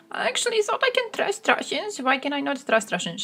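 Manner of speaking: high, whining mock disbelief; mock whine. Israel complaining about Russia, high mock whine. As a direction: whine like a naive dupe. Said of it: "Israel is like".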